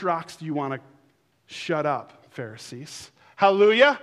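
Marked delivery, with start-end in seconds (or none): 3.4-4.0 loud, bright hallelujah; joyful exclamation. Real, not acted. Preacher exclaiming praise to congregation, loud. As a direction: burst out praising.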